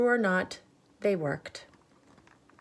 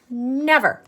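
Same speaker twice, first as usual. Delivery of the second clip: stubborn no; 6 semitones higher, 7 dB louder than usual. Acted. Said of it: "said Annalisa".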